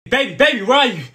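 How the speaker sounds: high, loud panicked shout; frantic calling. Acted. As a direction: call out desperately for your sweetheart. Frantic search for partner.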